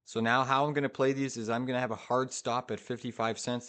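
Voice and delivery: normal talking voice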